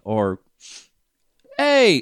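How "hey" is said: "Hey." pitched high and sharp; high British "Hey.". Shown as a demonstration. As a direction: call "Hey." up high like a Brit. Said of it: "British people say it's like".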